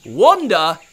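high, loud triumphant yell; boy yelling gleefully. A narrator's reading. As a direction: call her out triumphantly. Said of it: "yelled Pablo".

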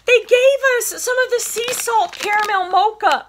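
high, loud delighted squeal with a laugh; squealing excitement. Real, not acted. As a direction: burst out laughing with joy over the treat. Thrilled at getting almond roca.

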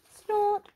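high, loud pig snort; short sharp snort noise; piglet snort. A narrator's reading. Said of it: "George snorted in agreement".

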